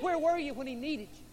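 high reproachful plea; pleading reproach. Acted. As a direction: ask where he was, hurt. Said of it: "She's pleading".

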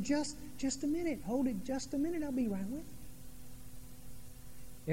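high, hushed mock mom voice; distracted mother. Mom to her interrupting little boy, high and soft imitated put-off.

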